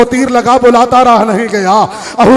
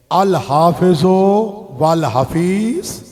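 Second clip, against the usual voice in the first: very slow, soft, stretched farewell words; drawn-out farewell; 3 semitones lower, 4 dB quieter, much slower than usual. Acted. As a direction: let each word of the farewell hang. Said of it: "जिस तरह भी जाकिर पढ़ते हैं". (धीमी, नरम, खिंची हुई अलविदा)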